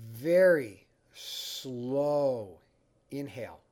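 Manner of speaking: drawn-out, stretched VERY SLOW; slow, lingering instruction, pausing between words. Shown as a demonstration. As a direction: draw the words out long and unhurried. Demonstrating the slow inhale he teaches.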